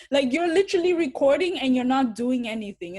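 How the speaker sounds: exasperated and loud; indignant outburst. Real, not acted. Indignant at filming bystanders.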